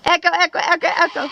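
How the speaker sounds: canyon echo. Shown as a demonstration. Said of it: "Can you hear the echo?".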